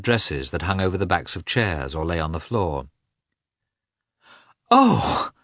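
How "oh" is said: high delighted gasp of surprise; light up at the sight of him; a bright, happy "Oh!"; joyful cry. A narrator's reading. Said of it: "she cried when she saw him".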